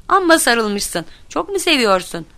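lightly jeering question; mocking. A narrator's reading. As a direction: poke fun at a friend. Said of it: "diye alay ediyormuş".

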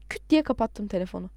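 ordinary delivery

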